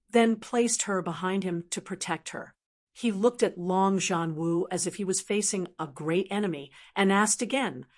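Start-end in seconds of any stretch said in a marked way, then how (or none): none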